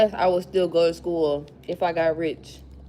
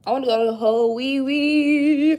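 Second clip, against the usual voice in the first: mock; 4 semitones higher, 5 dB louder than usual. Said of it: "Mine is".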